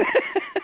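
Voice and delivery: shrill burst of laughter; high squeal laugh. Real, not acted. Laughing at the quote.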